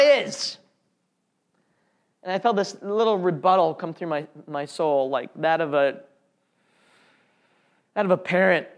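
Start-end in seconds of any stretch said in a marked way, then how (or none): none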